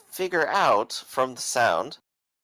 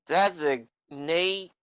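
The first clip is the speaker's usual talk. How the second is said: put-on higher, slurring rowdy drunk; 6 semitones higher than usual. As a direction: play a belligerent tavern drunk.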